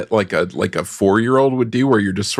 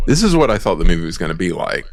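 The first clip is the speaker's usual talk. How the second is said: ordinary delivery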